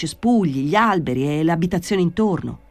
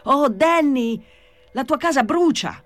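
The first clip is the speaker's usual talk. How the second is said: high-pitched alarmed call, moderately raised; urgent shouted warning; 9 semitones higher than usual. A narrator's reading. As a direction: call out to warn of a fire. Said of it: "gridò Gesù Maria".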